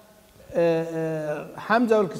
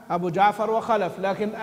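ordinary delivery

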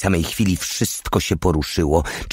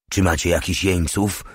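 plain reading voice

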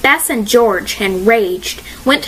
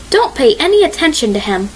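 plain reading voice

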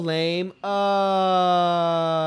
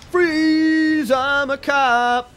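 much higher, louder "Freeze!", slow "I'm a cop"; mock cop shout; 7 semitones higher, 7 dB louder, much slower than usual. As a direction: shout like a cop, drag the end. Repeated cop bit.

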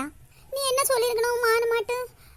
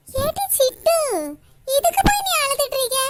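higher and louder put-on voice, calling out; loud calling aunt; 6 semitones higher, 4 dB louder than usual. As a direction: shout across to her and chide her. Calling out to a crying Chittu. (உரத்த குரலில் கூப்பிடும் அக்கா)

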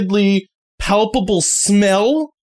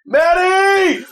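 calling out; 11 semitones higher, slower than usual. Acted. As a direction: holler it across the house, slow. Teen yells for roommate.

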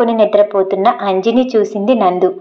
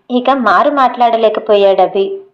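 ordinary delivery

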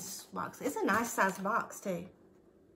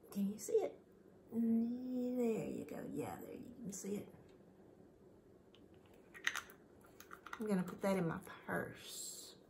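whispering; 7 dB quieter than usual. Real, not acted. Whispered aside while adjusting.

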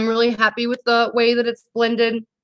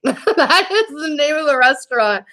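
high-pitched, laughing through the words; amused, laughter lifting the voice; 5 semitones higher than usual. Real, not acted. Laughs over the restaurant's name.

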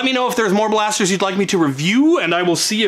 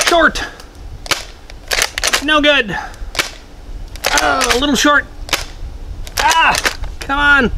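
frustrated shouts; 9 semitones higher than usual. Real, not acted. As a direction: yell at the missed darts. Groaning at missed shots.

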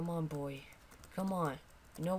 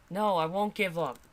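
usual speaking voice

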